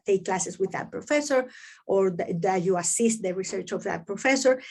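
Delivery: usual speaking voice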